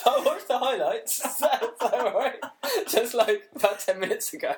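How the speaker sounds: high, loud words broken by laughter; laughing. Real, not acted. Confessing he only just watched the highlights, laughing hard. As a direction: barely get it out for laughing. Still cracking up over his confession.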